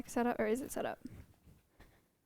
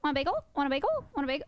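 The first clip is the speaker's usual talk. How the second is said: lightly squawky parrot voice, pitched up; parrot imitation; 3 semitones higher, faster than usual. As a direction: mimic a parrot asking for a bagel. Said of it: "he'd go".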